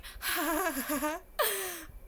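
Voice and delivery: high laugh breaking into crying; tearful sobbing laugh. Real, not acted. Fan reliving meeting her idol, tearful sobbing laugh. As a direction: laugh through the tears. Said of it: "I'm crying now".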